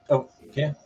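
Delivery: usual speaking voice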